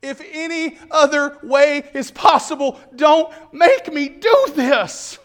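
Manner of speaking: a high anguished cry on Daddy, fading to a broken quiet I can't; begging in anguish, voice collapsing to near silence. Acted. Jesus in Gethsemane cries to his father, anguished then fading. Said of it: "Jesus is crying out".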